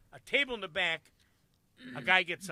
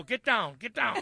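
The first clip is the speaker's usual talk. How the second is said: raised, loud command; retold panicky order; acted urgent warning; 5 semitones higher, 9 dB louder, slower than usual. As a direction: call it out like ducking for cover.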